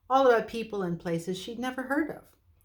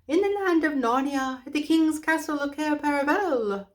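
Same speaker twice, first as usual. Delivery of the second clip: high, hooting owl voice; a fussy old owl speaking up; 9 semitones higher, 6 dB louder than usual. Acted. Owl character voice.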